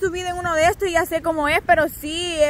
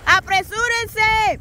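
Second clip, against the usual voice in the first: calling out; 6 semitones higher, much slower than usual. Real, not acted. Calling to friends behind.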